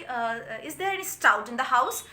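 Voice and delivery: slow, drawn-out request for drink; slow demand. Retold or imitated. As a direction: savour each word slowly. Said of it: "फिजरॉल बोलती है कि". (धीमी, खिंची हुई आवाज़ में)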